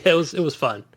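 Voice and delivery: usual speaking voice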